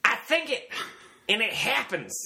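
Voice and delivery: high, intense cult-leader boast; Charles Manson impression. Quoting the killer to a co-host, high Manson impression. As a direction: pitch it high, like a menacing guru. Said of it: "don't listen to my impression".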